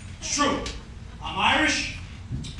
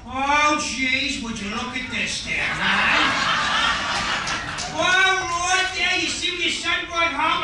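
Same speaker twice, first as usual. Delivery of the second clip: put-on old school Irish dad, loud and rattled; father freaking out; 2 semitones higher, 3 dB louder than usual. Dad panicking to his son over the living room crowd, loud mock alarm. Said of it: "It's like this".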